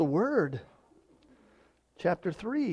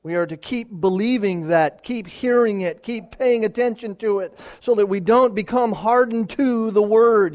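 louder with each clause, urging; preaching with rising force; 5 semitones higher, 8 dB louder than usual. Acted. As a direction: build the exhortation line by line.

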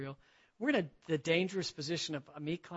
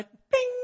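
realization ding; 8 dB louder than usual. Retold or imitated. Sudden realization sound effect.

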